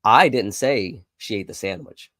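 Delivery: I hit hard at the start; stress on I. Shown as a demonstration. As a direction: punch the word I, rest falls away. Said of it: "change the emphasis on different words".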